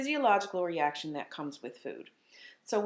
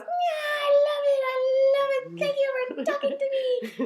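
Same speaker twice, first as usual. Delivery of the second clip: very high, louder happy squeal; overjoyed puppet; 7 dB louder than usual. Pretend. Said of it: "he goes".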